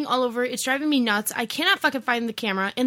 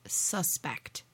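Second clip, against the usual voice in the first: ordinary talk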